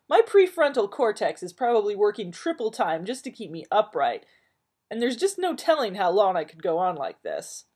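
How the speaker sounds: loud, high melodramatic rant; hammed-up self-pity; Joel ranting. Tired man ranting at Marilyn, loud and melodramatic. Joel rants about sleep.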